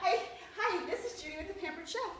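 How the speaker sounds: bright, high, laughing demo of the opener; peppy sales script read; energetic script. Shown as a demonstration. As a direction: sell it with excitement. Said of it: "gotta say it with energy and excitement".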